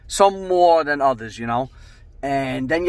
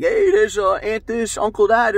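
high, loud camcorder greetings; raised, showy home-video commentary; father filming guests; 8 semitones higher, 3 dB louder than usual. Retold or imitated. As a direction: imitate a tipsy amateur cameraman hailing relatives. Tipsy father narrating his camcorder video.

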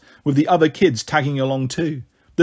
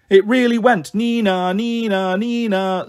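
put-on high child's voice doing a siren; excited child imitating siren; 6 semitones higher, slower than usual. A narrator's reading. Small girl telling sister about the ambulance, imitating its siren. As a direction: sing out the two-note siren in a high voice. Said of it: "said Pixie".

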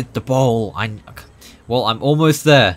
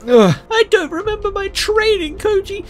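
squeaky, much higher whining fish voice; whiny fish. Acted. Scared fish whining back at its coach Koji. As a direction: whine like a scared little fish.

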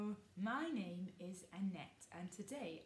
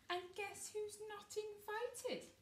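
sneer; 12 semitones higher than usual. Acted. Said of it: "sneered Gus".

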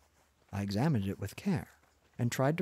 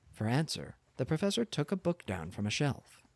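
ordinary delivery

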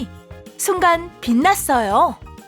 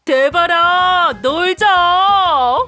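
drawn-out, higher call; calling out to a friend; 4 semitones higher, 4 dB louder, much slower than usual. Acted. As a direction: call a friend out to play, stretching the words. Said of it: "대발이를 불렀어요". (길게 늘여 친구를 부르는 목소리)

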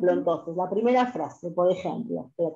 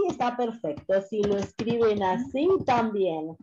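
ordinary delivery

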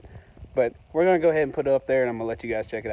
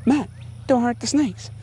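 imitating viewers; 11 semitones higher than usual. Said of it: "I know what you guys are thinking".

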